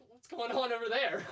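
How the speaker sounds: high, loud retold puzzlement; mock bewilderment. Nephew overhearing uncle's German shouting, mock bewildered. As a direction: react baffled to distant shouting. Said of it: "looking over there like".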